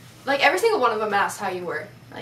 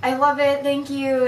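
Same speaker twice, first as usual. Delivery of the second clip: higher, louder thankful gush; grateful excitement; 4 semitones higher, 6 dB louder than usual. Retold or imitated. Cameron thanking the fan for the elephant, high and loud. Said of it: "he was like".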